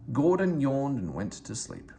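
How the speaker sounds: usual speaking voice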